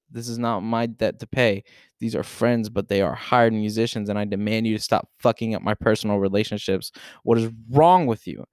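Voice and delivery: normal talking voice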